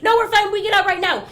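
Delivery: high, loud frightened pleading; scared couple begging the driver, shouting; mock panicked shout. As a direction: beg loudly to be let out. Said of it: "the loudest they've ever been".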